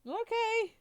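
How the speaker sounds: put-on very high, loud childlike voice; high-pitched eager little girl; little goblin girl. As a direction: chirp it like an obedient goblin kid. Goblin girl answers the adventurer.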